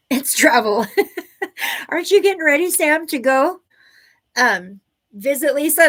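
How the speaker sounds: real amused excitement, voice lifted; laughing excitement. Real, not acted. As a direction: laugh with glee at having figured it out. Laughs after sudden realization about travel.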